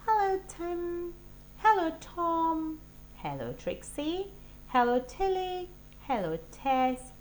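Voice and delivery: high, lightly voiced mum greeting; a hint of a cheery mother's voice; mother greeting each child. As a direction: sing out each name warmly. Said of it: "said Missus Tig".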